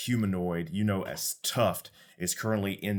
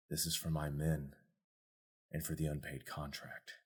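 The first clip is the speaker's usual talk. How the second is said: low, much softer deadly murmur; cold assassin; 4 semitones lower, 10 dB quieter than usual. Acted. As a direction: menace spoken under the breath. Assassin's low vengeful line.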